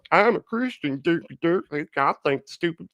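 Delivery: high, goofy dumb-guy voice. Retold or imitated. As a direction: parody a dim believer.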